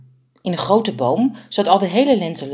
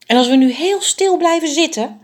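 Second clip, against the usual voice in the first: tall bird; 11 semitones higher, 4 dB louder than usual. A narrator's reading. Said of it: "vroeg de langste vogel".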